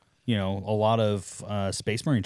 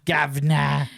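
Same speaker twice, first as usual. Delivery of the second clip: louder mock Cockney guv'nor; British accent; 4 semitones higher, 4 dB louder, much slower than usual. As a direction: bark it like a London cabbie. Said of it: "they all have a British accent".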